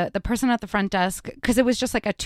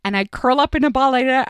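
normal talking voice

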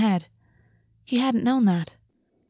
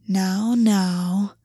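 lazy predatory. A narrator's reading. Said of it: "a lazy, predatory hunger".

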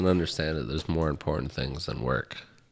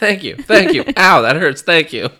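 high, loud squeaky pet voice; squeaky dog voice; 11 semitones higher, 12 dB louder than usual. Pretend. Voicing the dog being clipped.

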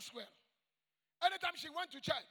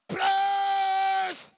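one long, high, sustained shout; imitated praise scream; 5 semitones higher, 9 dB louder than usual. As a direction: belt out the word and hold it. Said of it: "she would scream, "Praise the Lord".

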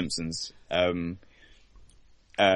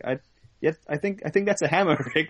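higher, hesitant mock Morty; Morty impression; 8 semitones higher, faster than usual. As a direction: correct him timidly, hammed-up. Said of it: "It's like".

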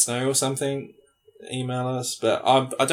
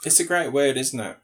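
normal talking voice

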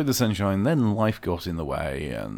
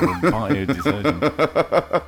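laughing; 6 semitones higher, 5 dB louder, much slower than usual. Real, not acted. Laughing joke about bio detergent.

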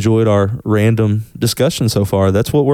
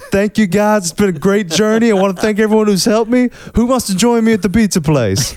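higher, grand farewell speech; retirement speech; 9 semitones higher than usual. Pretend. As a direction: thank everyone like a retiring star.

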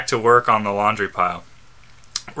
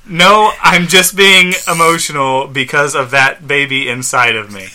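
higher, louder, emphatic retake; raised, careful second reading; corrected reread; 4 semitones higher, 5 dB louder than usual. Acted. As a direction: redo the line with more pitch and push. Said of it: "I didn't read that entirely correctly".

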